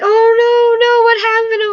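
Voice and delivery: loud, high-pitched mock alarm; imitated worried relatives, high and loud; alarmed concern. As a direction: fuss over someone in tears, voice way up. Retells relatives alarmed at crying.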